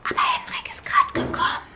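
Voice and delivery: hushed whisper. Real, not acted. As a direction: whisper it so he won't hear. Boyfriend just arrived, hushed.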